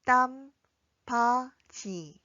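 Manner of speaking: 땀바지 very slowly, pausing between syllables; drawn-out, separated 땀바지 for learners to follow. Shown as a demonstration. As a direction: model 땀, 바, 지 one at a time. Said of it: "함께 연습해 볼까요".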